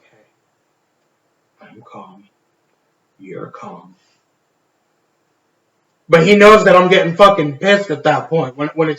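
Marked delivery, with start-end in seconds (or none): 0.0-4.0 much softer, almost whispered, through held-back anger; a barely voiced whisper that hides rising anger; lower and lower. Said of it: "the lower that I say it".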